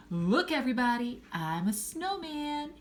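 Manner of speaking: high, stretched-out proclamation; proud announcement. A narrator's reading. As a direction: announce it to the whole room. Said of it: "he announced".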